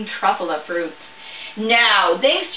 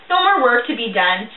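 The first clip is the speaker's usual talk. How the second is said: usual speaking voice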